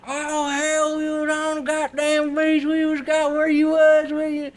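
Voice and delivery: high-pitched slurry country holler; screaming country drunk. Retold or imitated. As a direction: imitate a plastered man yelling in a thick country accent. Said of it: "he's screaming at the top of his lungs".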